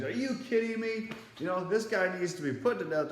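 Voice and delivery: mock Sadducees. Teacher voicing Sadducees raging against Paul, high indignant outcry. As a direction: squawk like outraged Sadducees demanding death. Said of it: "And then the Sadducees".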